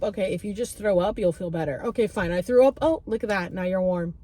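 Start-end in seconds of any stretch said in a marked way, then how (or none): none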